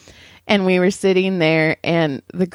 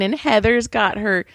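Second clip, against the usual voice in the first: ordinary talk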